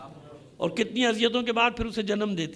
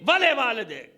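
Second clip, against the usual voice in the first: ringing preacher's cry; cried-out verse; 3 semitones higher than usual. Acted. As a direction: call the verse out over the crowd. Quranic verse cried out in sermon.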